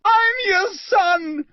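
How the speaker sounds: very high slow scream; desperate high scream, slow. Acted. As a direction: scream up at your father. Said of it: "he screamed up at Crouch".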